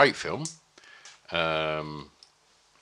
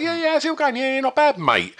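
much higher, louder breezy small talk; imitating small talk; 4 dB louder than usual. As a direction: breeze through the chat without knowing the name. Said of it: "They're like".

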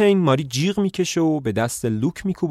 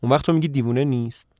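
plain reading voice

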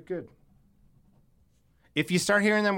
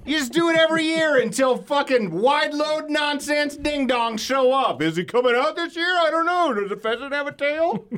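high excited rant; 10 semitones higher than usual. Real, not acted. Ranting over the groundhog lore.

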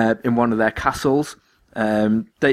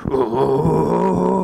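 long drawn-out, higher "oh"; imitated cartoon cry; 5 semitones higher than usual. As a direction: mimic a cartoon voice holding one long note. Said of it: "He's like".

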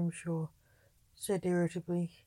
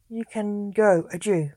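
put-on strange French accent; 7 dB louder than usual. A narrator's reading. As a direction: lay on a foreign French accent. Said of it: "with a strange French accent".

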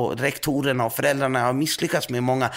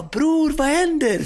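much higher mock voice, a bit louder; friend's surprised greeting; 3 dB louder, slower than usual. Friend at the unit answering the phone, in a high surprised voice. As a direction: squeak it up like a surprised buddy. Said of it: "Han bara".

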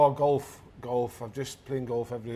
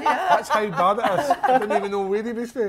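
loud laughing; 7 semitones higher, 10 dB louder than usual. Real, not acted. Laughing at accent joke.

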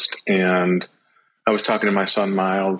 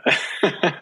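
short real laugh; a quick bright laugh; laughing at the compliment; 9 semitones higher than usual. Real, not acted. Laughs after badass remark.

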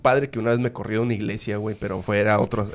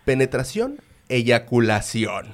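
slow, each word laid out; mock solemn priest, dragging every word; slow, deliberate quote; much slower than usual. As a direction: spell out each word slowly like a firm priest.

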